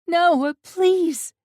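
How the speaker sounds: high, loud desperate plea; crying out. A narrator's reading. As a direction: cry it out in tears. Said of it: "I cry out".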